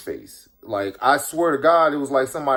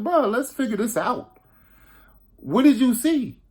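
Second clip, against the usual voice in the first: higher, keyed-up, pressing; urgent; 9 semitones higher than usual. Retold or imitated. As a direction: get to the bottom of it now. Sitting down to question friend.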